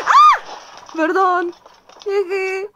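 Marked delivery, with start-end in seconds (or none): 0.1-0.4 sharp high startled yelp; startled scream. Real, not acted. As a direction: scream out in sudden fright. Jump scare while playing. (grito de susto)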